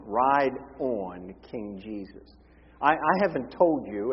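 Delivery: ordinary talk, nothing marked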